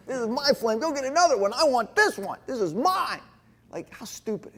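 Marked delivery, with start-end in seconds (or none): 0.1-3.2 higher, louder possessive protest; mock-stubborn whiny refusal; childish refusal. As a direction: clutch the torch like a stubborn kid. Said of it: "I go".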